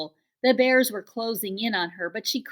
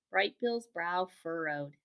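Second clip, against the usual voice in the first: ordinary delivery, nothing marked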